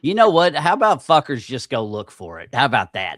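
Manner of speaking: loud, exasperated outburst; loud, fed-up rant at the viewers; annoyed rant. Real, not acted. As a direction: snap it at the chat, fed up. Ranting at viewers about missing video.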